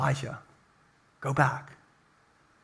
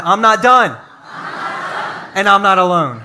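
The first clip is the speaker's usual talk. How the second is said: with conviction; 2 semitones higher, 5 dB louder than usual. Shown as a demonstration. Pastor leading the congregation to shout it back, loud. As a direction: say it like you believe it. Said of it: "let's say it with something".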